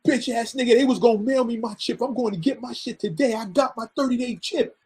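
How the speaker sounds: imitated furious, taunting tirade; angry. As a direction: act out a vindicated friend cussing someone out. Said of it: "And he's angry".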